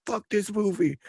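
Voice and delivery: high, held-back mock scream; imitated scream. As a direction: softly squeal it like a furious moviegoer.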